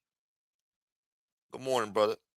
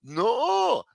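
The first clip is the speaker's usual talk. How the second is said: gate staff refusing; 14 semitones higher, 4 dB louder than usual. Retold or imitated. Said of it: "They say".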